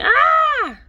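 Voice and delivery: sudden high-pitched battle cry; a kid's shriek mid-leap; pouncing yell. Acted. Boy springing on his brother with a yell.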